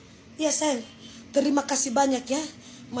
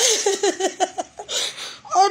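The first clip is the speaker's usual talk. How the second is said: high laugh close to crying; tearful laugh; 4 semitones higher than usual. Real, not acted. Streamer overwhelmed by gifts, tearful laughing. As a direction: laugh until it turns into sobs. Overwhelmed, says she wants to cry.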